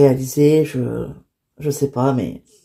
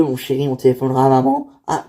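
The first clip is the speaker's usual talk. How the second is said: ordinary talk, nothing marked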